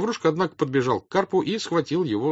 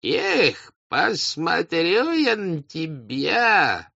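put-on high, very slow drunken drawl; boozy peasant's high slur; 9 semitones higher, much slower than usual. A narrator's reading. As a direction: stretch the words out like a drunkard. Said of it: "Два пьяные мужика".

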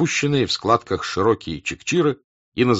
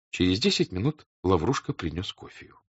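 ordinary delivery

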